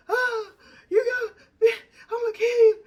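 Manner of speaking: high, wailing mock yell; crying, screaming fake rage; mock anguished scream. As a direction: howl it like a man losing his car. Boyfriend screaming over wrecked car.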